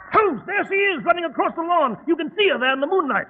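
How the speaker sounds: high, loud breathless pointing out; excited alarm. Acted. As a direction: shout out what you see in a rush. Spotting the witch on lawn.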